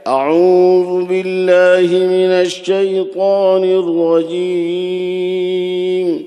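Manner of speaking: slow, drawn-out Quranic recitation with long vowels; Hardoi recitation style. Retold or imitated. Preacher imitating his teacher's slow recitation of the taawwuz. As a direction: recite it stretched out like the old teacher. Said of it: "یہ لہجہ میرے حضرت کا ہے".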